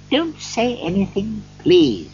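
high-pitched, kindly interruption; pleading Scrooge. A narrator's reading. Scrooge stops the gentleman thanking him, pleading. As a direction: cut the thanks off with a plea.

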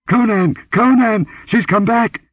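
very high, loud screaming for help; terrified shriek. Acted. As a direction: scream his name in terror. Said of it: "The shriek ended in a gurgle".

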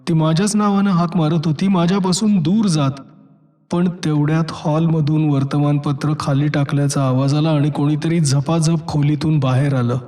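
ordinary delivery, nothing marked

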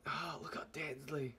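awed whisper at the pepper. Real, not acted. Vlogger whispering at the scary pepper. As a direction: breathe it out, voiceless and hushed. Repeats the line in awe.

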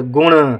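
गुण with its final ṇ sounded through the nose; गुण closing on a nasal retroflex ṇ; nasal ending. Shown as a demonstration. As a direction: let गुण ring out in the nose at the end. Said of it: "थोड़ा नाक से आवाज़ आती है".

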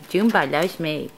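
ordinary delivery

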